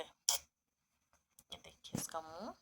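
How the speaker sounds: slow hushed whisper. Real, not acted. Owner showing the rabbit's face, slow whisper. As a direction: hush so as not to startle the pet.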